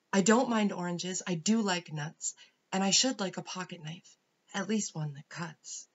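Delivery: hopeful King John reading out his wishes; emphatic, deliberate storybook plea to Father Christmas; King John's wish list. Acted. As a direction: recite a wishful Christmas list with relish. Voices King John's letter in poem.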